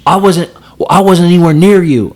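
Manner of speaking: a touch of the angry driver; mocking the driver. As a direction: mimic the guy's defensive whine.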